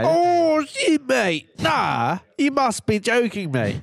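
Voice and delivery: very high, mock-shocked Aussie; intense Australian accent. Reacting to mate's hacker yarn, intense Australian accent. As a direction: wail it as a stunned pretend Australian. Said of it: "very intense in your Australian accent".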